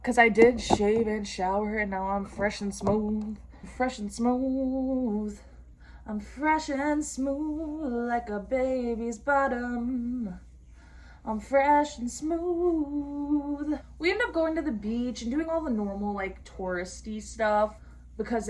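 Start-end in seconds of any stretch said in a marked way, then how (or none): 3.8-5.4 stretched, silky repeat; playful slow silky voice; slow drawn-out put-on voice. Shown as a demonstration. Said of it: "slow put-on repeat". 6.1-13.8 drawn-out silky voice; playful slow sexy voice; slow sultry put-on voice. Repeats phrase in slow put-on voice.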